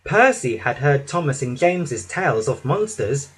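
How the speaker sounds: ordinary delivery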